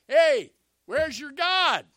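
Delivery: very loud, high, drawn-out taunt; mocking shout. Elijah going at the leaping prophets, mocking shout. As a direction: bellow the jeer across a crowd. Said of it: "And Elijah's going".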